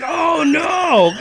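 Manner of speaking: very high, stretched mock wail; horrified realisation. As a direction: wail it in hammed-up horror. Repeats mirror horror in story.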